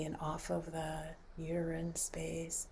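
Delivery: slow, breathy whisper. Acted. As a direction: drop to a hush as if not to wake anyone. Guided relaxation, hushed.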